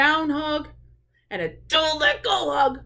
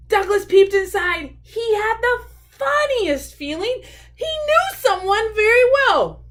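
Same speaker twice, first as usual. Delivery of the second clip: high, loud storytime reading; excited story narration; 10 semitones higher, 7 dB louder than usual. A narrator's reading. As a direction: build excitement for young listeners. Reads a picture book aloud.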